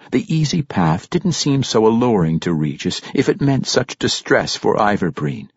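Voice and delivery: plain reading voice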